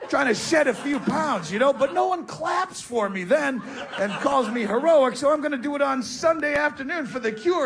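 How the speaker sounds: ordinary delivery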